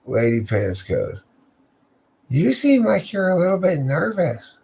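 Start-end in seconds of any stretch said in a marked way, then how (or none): 2.3-4.6 much higher teasing woman's voice. Acted. The lady at the door teases Fabian in a high voice.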